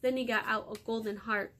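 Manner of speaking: plain reading voice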